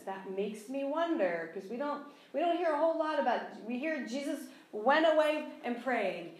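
ordinary delivery

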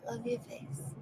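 whisper. Pretend. Whispered affirmation to own face.

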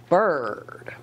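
ordinary delivery, nothing marked

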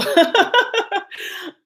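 squealing high laugh; high burst of laughter. Real, not acted. Laughs at the cards.

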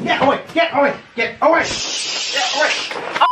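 high, urgent repeated cries; frantic shooing. Pretend. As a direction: shoo them off in a high voice. Shooing someone away, repeated.

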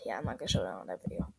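fast whispered aside. Real, not acted. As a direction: mutter it under your breath quickly. Unvoiced aside.

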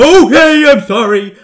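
loud, high jump-scare yelp; startled yell. Real, not acted. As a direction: cry out in sudden fright. Game scare interrupts his boast.

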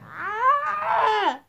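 loud high scream of alarm; startled scream. Real, not acted. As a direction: shriek in sudden panic. Zombie attack scream.

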